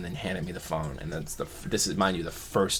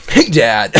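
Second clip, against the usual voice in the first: much louder, bright chatty hello; cheery greeting; 3 semitones higher, 15 dB louder than usual. Retold or imitated. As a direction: call out to Dad all friendly and upbeat. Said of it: "I was like".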